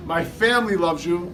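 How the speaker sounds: ordinary talk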